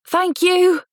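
high, loud call across the room; calling after. A narrator's reading. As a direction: call it after him as he leaves. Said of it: "I called after him".

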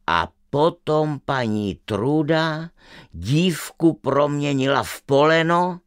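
plain reading voice

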